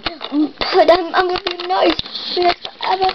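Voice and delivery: high pleading pet voice; cute pet voice. Pretend.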